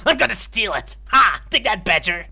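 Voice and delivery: high, loud mock-villain triumph; gleeful gloating. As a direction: crow over a petty theft. Gleefully robs a sleeping badger.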